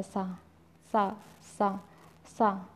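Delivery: सा with plain s, said very softly, repeated; whisper-soft सा, tongue at the teeth; quiet repeated demo of dental sa. Shown as a demonstration. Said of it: "सीधे सीधे सा बोला जाता है".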